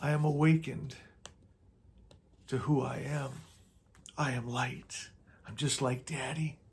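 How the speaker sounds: soft affirmation. Acted.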